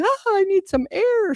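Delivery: high squeaky gasping worm; little worm voice. Acted. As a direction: play a tiny worm needing air. Voicing worms coming up.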